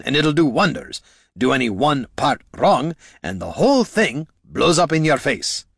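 a lightly voiced old adventurer lecturing the lad; gruff veteran mentor. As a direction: drive the point home like a seasoned old hand. Gorm lecturing Niln in character.

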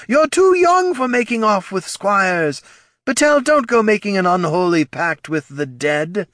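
high, whiny mock of a fussy father; mocking older man. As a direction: nag like a disapproving dad. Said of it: "unflattering impression of an older man".

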